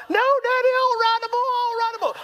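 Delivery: hammed-up kid shrieking in protest; screaming child. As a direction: wail it high, begging Dad. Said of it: "starts screaming the closer we get".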